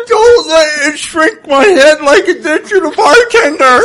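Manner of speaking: slow, laughing, thick-tongued plea; swollen tongue talk. Acted. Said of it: "stop trying to talk".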